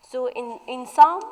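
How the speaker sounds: ordinary talk, nothing marked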